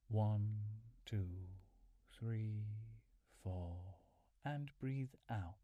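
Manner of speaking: steady meditation count with long, even gaps. Real, not acted. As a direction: count out the breaths, leaving a pause after each number. Counts second round of breathing.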